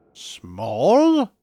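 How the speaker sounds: a scoffing echo of the word; incredulous echo. Acted. Scrooge echoing the ghost's word, incredulous. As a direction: question the word back in disbelief. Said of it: "echoed Scrooge".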